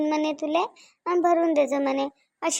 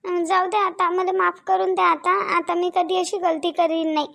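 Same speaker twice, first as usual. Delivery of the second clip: pleading; 4 semitones higher than usual. Acted. Wife begs angry husband to forgive her, pleading high. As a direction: plead as if dreading a beating.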